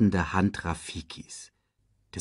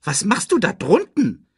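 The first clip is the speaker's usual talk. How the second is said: high, loud and baffled; squeaky sidekick demanding an answer; puzzled Timon voice; 12 semitones higher, 5 dB louder than usual. A narrator's reading. Puzzled Timon asks trapped friend.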